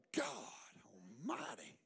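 reenacted awe, breathed out very low; near-whispered exclamation; hushed awe. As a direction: drop to a stunned, voiceless hush. Retold awed reaction to son.

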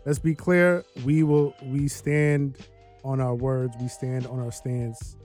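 usual speaking voice

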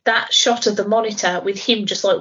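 ordinary talk, nothing marked

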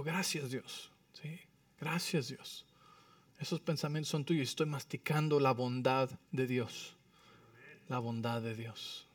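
soft, low, reverent musing; quiet thankful prayer. Acted. Preacher voices his thanks to God for family, hushed prayer. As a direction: hush it down into private gratitude.

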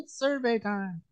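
put-on high, soft, drawn-out child voice; imitated daughter's promo. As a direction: copy a little girl slowly echoing a catchphrase. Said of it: "She's like".

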